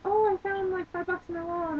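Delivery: high, chirpy retold exclamation; acted excitement. Coworker announcing money found in her wallet, acted excitement. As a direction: imitate an excited girl, pitched well up.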